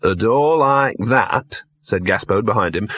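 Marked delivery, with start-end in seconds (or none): 0.0-1.6 drawn-out, weighty "A door like that"; slow pronouncement. Acted. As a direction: stretch out each word slowly. Dog drawing out an opinion.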